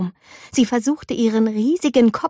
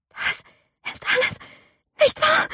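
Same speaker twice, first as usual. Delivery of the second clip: to herself; 4 dB quieter than usual. A narrator's reading. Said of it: "sagte Mei zu sich selbst".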